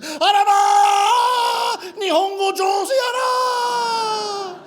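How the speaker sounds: high, slow, shrieking Osaka shop lady; shop lady's shriek. Retold or imitated. Convenience store clerk shrieking praise at a foreign customer. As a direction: gush in a piercing high cry of amazement. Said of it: "and she said".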